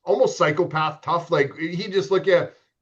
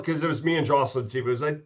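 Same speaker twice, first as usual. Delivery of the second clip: usual speaking voice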